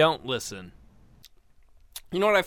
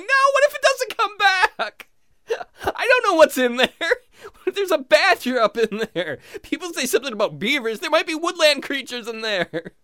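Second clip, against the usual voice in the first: very high, louder, laughing panic; panicked high squeal; 11 semitones higher, 5 dB louder than usual. Retold or imitated. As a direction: squeal with comic terror. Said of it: "I'm like".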